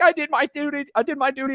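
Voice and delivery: high, self-satisfied sing-song; mock dutiful churchgoer. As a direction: parody a smug Sunday attendee. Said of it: "I'll be like".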